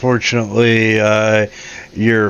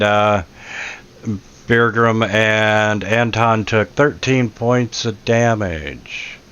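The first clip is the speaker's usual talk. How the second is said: ordinary delivery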